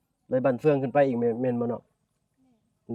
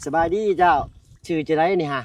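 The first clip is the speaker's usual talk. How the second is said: calling out; 5 semitones higher, 8 dB louder than usual. Real, not acted. Greeting a villager across distance.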